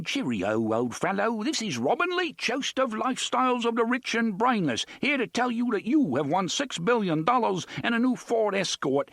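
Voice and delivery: high, hammed-up posh British game-show host; over-the-top plummy British announcer voice; bogus plummy British accent. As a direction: bellow it like a fake British TV host. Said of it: "adopting a plummy and completely bogus British accent".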